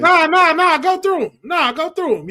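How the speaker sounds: high, loud, eager push; excited urging. Real, not acted. Host eagerly urges cohost to read the names, high and loud. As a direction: egg her on to keep reading.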